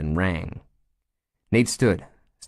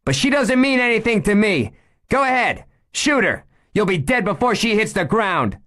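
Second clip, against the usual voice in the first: high, loud, scornful dare; cold defiance; 4 dB louder than usual. Acted. Meg daring the gunman to shoot, loud and scornful. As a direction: dare him to pull the trigger.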